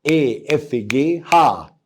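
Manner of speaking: usual speaking voice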